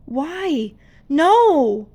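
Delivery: rising from whisper to a loud cry; anguished cry. Acted. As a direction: disbelief breaking into a cry. Father sees girls fight.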